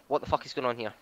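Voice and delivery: ordinary delivery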